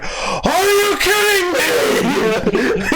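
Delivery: high, slow, strained disbelief as the fed-up dad; exasperated dad. Retold or imitated. Retelling the dad's reaction to his daughter, high exasperated squeal. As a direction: squeal out the father's outrage, drawn out.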